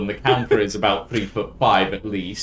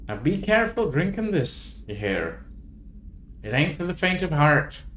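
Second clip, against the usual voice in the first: barmaid warning; 6 semitones higher than usual. Acted. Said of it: "and goes".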